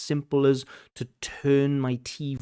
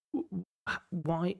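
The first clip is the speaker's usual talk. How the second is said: exasperated why; 7 semitones higher, 5 dB quieter than usual. Real, not acted. Reacting to stacked synonyms.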